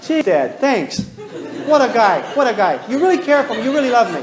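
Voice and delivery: put-on sarcastic kid gratitude. Speaker mocks a kid who thanks Dad, hammed up and laughing. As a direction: ham up the kid's fake thanks, laughing. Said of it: "Do you think that little kid is gonna say".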